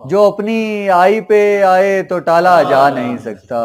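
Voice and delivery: projected, ringing verse delivery; ghazal recitation. Acted. Poet repeating a couplet to a cheering audience, projected recitation. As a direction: declaim the couplet for applause.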